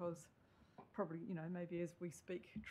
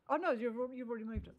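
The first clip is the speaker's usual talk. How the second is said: sudden realisation; 5 semitones higher, 7 dB louder, faster than usual. Real, not acted. Chair realises motion already moved.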